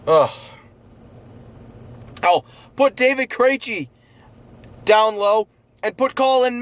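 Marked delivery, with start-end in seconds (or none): none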